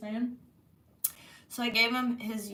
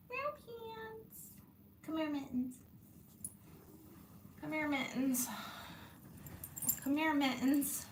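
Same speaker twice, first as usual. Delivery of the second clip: higher, soft coaxing call; pet voice to cat; 7 semitones higher, 6 dB quieter than usual. Real, not acted. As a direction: coax the cat over sweetly. Calling the cat sweetly.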